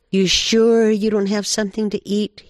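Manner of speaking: high, loud, insistent begging; wheedling coyote. Acted. Hungry Coyote presses Badger for food, high wheedling.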